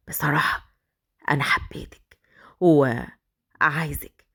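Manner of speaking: whispered, slow confession; whispered seduction. Acted. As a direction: breathe it close and slow. (همس مغرٍ وبطيء)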